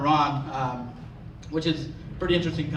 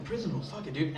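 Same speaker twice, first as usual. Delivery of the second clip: hushed resolve; 2 semitones lower, 11 dB quieter than usual. Retold or imitated. Muttering resolve before the attack.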